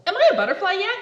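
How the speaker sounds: playful rushed pestering; cheeky laughing ask. Acted. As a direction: sneak the question in.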